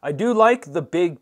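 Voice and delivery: normal talking voice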